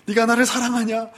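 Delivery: Jesus pressing Peter a third time; pressing question. Retold or imitated. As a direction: push harder the third time.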